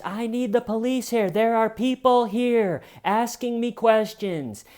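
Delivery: mock frantic police call; wild panic. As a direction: shriek for help like it's an emergency. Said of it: "he's wildly calling the police".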